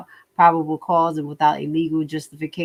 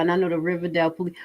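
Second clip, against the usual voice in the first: usual speaking voice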